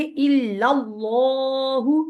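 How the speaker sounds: इल्लल्लाहु recited with a full heavy lam; heavy Allah. Shown as a demonstration. Teacher demonstrating heavy recitation. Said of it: "ऐसे करके पढ़ेंगे".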